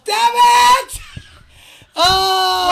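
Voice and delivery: loud, high shout of defeat; frustrated shout. Real, not acted. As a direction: yell it, the take is ruined. Broke the take.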